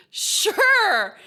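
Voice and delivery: high, loud "Sure"; exaggerated sure. Real, not acted. Player going along with the prison store joke, exaggerated high "Sure".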